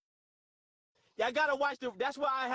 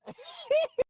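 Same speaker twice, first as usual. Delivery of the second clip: high squealing giggle; helpless laughing. Real, not acted. Streamer laughing despite complaints, high squealing laugh. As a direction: dissolve into high-pitched laughter. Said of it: "I'm laughing".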